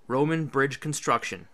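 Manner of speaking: ordinary delivery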